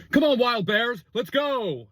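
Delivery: a mock basketball team cheer from the crowd. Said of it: "high school basketball team".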